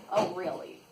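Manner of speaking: ordinary delivery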